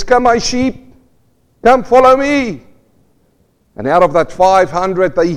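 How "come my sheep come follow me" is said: shepherd's call. Acted. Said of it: "He says".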